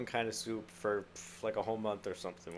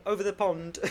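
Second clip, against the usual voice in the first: quick high British "Over the pond", louder, with a crisp "pond"; jokey British accent, pitched up, on "Over the pond"; 10 semitones higher, 6 dB louder than usual. Shown as a demonstration. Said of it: "Let's hear the James Bond accent".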